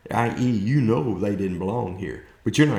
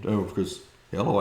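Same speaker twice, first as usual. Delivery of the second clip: ordinary talk, nothing marked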